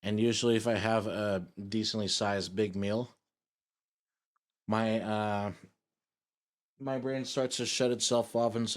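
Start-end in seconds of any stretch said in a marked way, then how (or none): none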